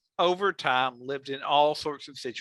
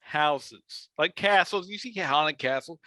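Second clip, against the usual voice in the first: usual speaking voice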